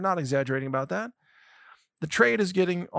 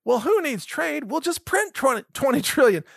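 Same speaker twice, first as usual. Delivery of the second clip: mocking politicians; 8 semitones higher than usual. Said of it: "who say".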